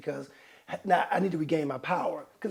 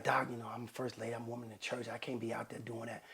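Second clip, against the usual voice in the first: quiet scandalized wife; 7 semitones lower, 14 dB quieter than usual. Retold or imitated. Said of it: "she's like".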